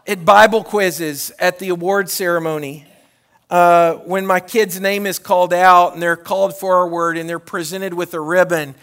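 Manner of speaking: ordinary delivery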